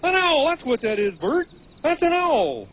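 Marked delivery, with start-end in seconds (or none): 0.0-0.5 loud, high burst of discovery; excited shout. Acted. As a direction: blurt out the answer at top volume. Repeats the excited guess.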